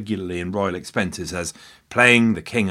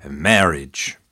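American pronunciation; 5 semitones lower than usual. Shown as a demonstration. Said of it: "In America, we say".

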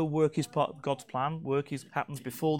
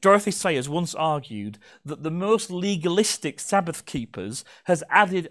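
usual speaking voice

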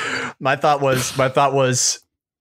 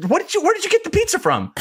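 much higher, fast, frantic questions; frantic brother; faster than usual. Retold or imitated. Brother frantically asks where the pizza came from, high and fast. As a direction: rattle it off in a panic.

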